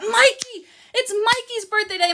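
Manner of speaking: high-pitched birthday cheer; excited hailing. Real, not acted. Host announces a viewer's birthday, excited and high. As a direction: call out the name high and thrilled.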